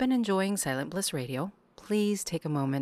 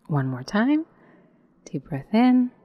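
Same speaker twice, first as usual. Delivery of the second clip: guided meditation. Acted. Guide leading listeners into yoga nidra, calm meditation voice. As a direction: lead the breath in on a rise, let the exhale fall away.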